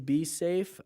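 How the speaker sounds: ordinary delivery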